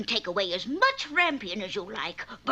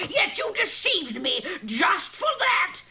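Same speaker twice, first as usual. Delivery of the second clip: angry witch; 8 semitones higher than usual. Acted. Witch scolds Rapunzel.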